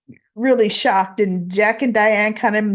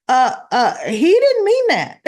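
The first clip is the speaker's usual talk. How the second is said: Tracy's disbelief; 6 semitones higher, 3 dB louder than usual. Retold or imitated. Tracy reassures, voiced in story.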